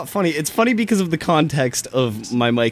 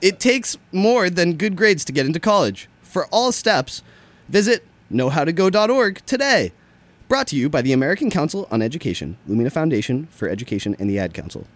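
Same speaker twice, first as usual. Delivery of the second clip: high, punchy announcer easing down at the sponsor credits; excitable college ad read; jumpy PSA; 3 semitones higher than usual. Acted.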